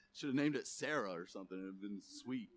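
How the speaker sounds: ordinary talk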